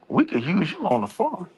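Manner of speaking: lightly voiced old woman marvelling at "big guy"; mock old lady.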